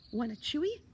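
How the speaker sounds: high, loud offer called from a tree; high holler with rising end; calling down. A narrator's reading. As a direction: call down in a squeaky kid voice. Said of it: "the voice called down".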